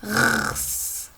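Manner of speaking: RS as bare sounds, long rasped r into hissed s; letter sounds. Shown as a demonstration. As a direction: sound out "Rs" without vowels. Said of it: "on lit les autres lettres". (sons des lettres « Rs » prolongés, sans voyelle)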